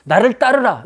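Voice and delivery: high, loud summons; challenging call. Acted. Preacher voices Jesus calling disciples to follow, challenging call. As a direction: issue it as a bold command. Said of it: "그렇게 도전하시고".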